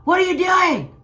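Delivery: loud, very high-pitched mom yell. Retold or imitated. Mom yelling at sister on the phone, loud shout. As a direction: holler it down the phone. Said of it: "And my mom yells".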